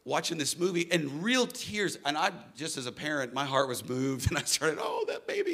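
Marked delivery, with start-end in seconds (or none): 4.8-5.5 high tender coo; much higher, tender "aww" voice. Retold or imitated. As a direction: coo over a crying child. Moved parent cooing at video.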